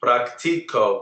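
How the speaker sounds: Practico with the C sounded like a K; slow, teacherly Practico, hard C. Shown as a demonstration. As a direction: say Practico slowly with a clear K sound for the C. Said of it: "that C is a hard C".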